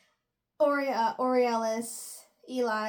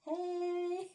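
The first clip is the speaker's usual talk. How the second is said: a smiling, higher, softer greeting; grinning hey; 7 semitones higher, 6 dB quieter than usual. Retold or imitated. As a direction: greet him through a smug grin while being choked.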